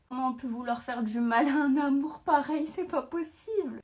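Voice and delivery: high, loud cooing over a beloved pet; doting pet talk. Real, not acted. Owner cooing over her threatened little animal, high doting pet talk.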